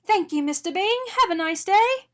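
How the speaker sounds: cheerful baker seeing a customer off. A narrator's reading. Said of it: "Arnie heard the baker say".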